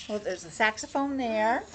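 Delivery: ordinary delivery, nothing marked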